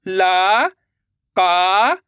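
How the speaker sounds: slow spelling-out, every syllable held long; syllable-by-syllable reading. Shown as a demonstration. Teacher leading learners to repeat along, slow and separated. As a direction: separate the sounds with clear gaps. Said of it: "मेरे साथ साथ इनको repeat करना है".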